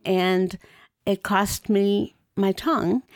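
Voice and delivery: usual speaking voice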